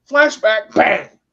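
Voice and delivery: loud, punched exclamation; abrupt loud bang for effect; sudden impact. Shown as a demonstration. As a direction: hit both words hard like a blow. Imitating the impact of a flashback.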